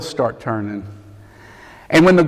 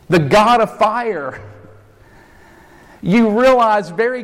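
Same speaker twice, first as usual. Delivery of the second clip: higher, rising pulpit fervour; fervent preaching; 5 semitones higher than usual. Real, not acted. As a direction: build the altar-call passion.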